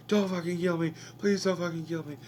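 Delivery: high, strained begging; desperate pleading. Retold or imitated. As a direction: beg them not to kill you. Captive begs for his life.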